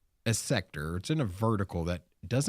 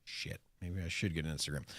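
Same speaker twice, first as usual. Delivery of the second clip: soft, quick, resigned; quiet resigned mutter; 6 semitones lower, 12 dB quieter, faster than usual. Retold or imitated. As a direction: mutter it, giving in. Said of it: "You're like".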